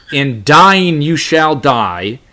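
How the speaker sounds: slow, weighty Hebrew phrasing; emphatic rendering. Shown as a demonstration. Teacher reading the Hebrew wording slowly, emphatic. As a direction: lay heavy stress on dying. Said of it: "even more emphatic".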